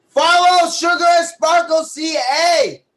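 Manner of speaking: slow, loud, high call-out; shouted promo. Real, not acted. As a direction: announce it to the crowd.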